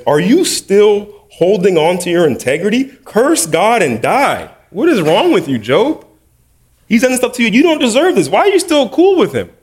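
rising high, pleading voice of Job's wife. Acted. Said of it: "Job's wife says".

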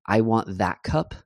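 slow English line with that stressed; I want THAT cup, said slowly with that punched. Shown as a demonstration. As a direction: lean on the word that to pick one cup. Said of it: "el énfasis en that".

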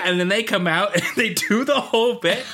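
ordinary talk

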